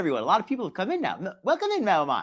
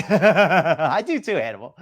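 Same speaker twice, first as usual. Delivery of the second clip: laughing through the words; slow, laughing reply; 6 dB louder, slower than usual. Real, not acted. Laughing while greeting a viewer.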